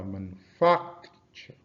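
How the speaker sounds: slow manufacture, manu-FAC-ture; stressed-syllable demo. Shown as a demonstration. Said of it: "practice with words like".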